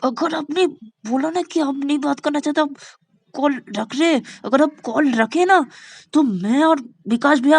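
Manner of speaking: high, loud scolding; urgent threatening prompt. Acted. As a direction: press her hard with a threat. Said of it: "इशारे में उसके दी को बोलती है".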